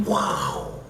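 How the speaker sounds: breathy, voiceless wow of amazement; stunned wow. Retold or imitated. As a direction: let out an awestruck, airy wow. Awed reaction to plot twist.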